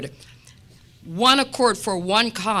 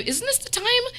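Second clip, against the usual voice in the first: coworker's voice pitched higher, mildly curious; asking her coworker about her holy days, in a higher mock voice; imitating coworker; 9 semitones higher than usual. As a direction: lightly play the curious coworker. Coworker quoted, high-pitched.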